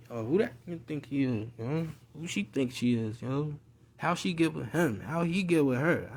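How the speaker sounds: hushed mock gossiping; envious gossip. Haters muttering about who got something, envious and hushed. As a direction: imitate jealous onlookers under your breath.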